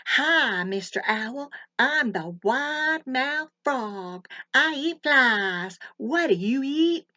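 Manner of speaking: high, loud, slow wide-mouthed frog boast. A narrator's reading. Frog greeting the bunny in a high, loud, slow voice. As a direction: stretch the words wide like a big proud frog.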